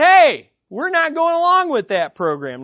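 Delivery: loud, high-pitched mock protest; grumbling crowd. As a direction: protest loudly like a balking crowd. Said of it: "They grumbled, they murmured".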